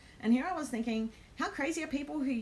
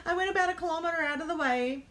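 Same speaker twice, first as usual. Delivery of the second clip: laughing; 8 semitones higher, 3 dB louder than usual. Real, not acted. Laughing at her long detour.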